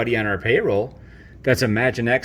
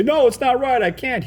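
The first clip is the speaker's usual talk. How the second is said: high, pleading refusal; a higher, begging no; pleading henchman; 11 semitones higher than usual. Retold or imitated. Minion refusing Palpatine's evil order, high pleading voice. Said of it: "They say".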